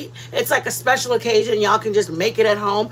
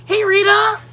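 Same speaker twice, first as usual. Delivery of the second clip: bright greeting; 8 semitones higher, 5 dB louder than usual. Real, not acted. Greeting live chat viewers.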